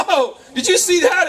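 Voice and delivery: high, startled exclamation; acted amazement. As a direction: call out in mock astonishment. Startled bird watcher, acted quote.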